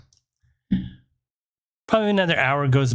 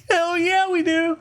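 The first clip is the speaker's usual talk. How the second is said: much higher, laughing mock-eager reply; excited agreement. Friends eagerly taking up the cop's offer, giddy high voice. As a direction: retell it in a giddy, high-pitched cheer.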